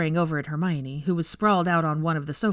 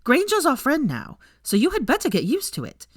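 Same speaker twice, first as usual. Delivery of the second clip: higher, louder firm rebuke; stern; 10 semitones higher, 4 dB louder than usual. A narrator's reading. Pansy to Millicent, high stern defence of Granger. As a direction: scold her hard.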